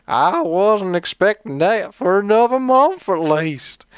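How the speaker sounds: high, slow, hammed-up cowboy drawl; cowboy accent. Host reading his number one fan's question, hammed-up cowboy accent. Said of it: "might say it in a certain accent".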